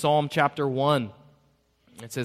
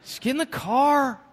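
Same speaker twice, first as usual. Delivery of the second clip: mock yell; 14 semitones higher, 10 dB louder than usual. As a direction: bark it at a crying daughter, hammed up. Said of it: "did I yell at her".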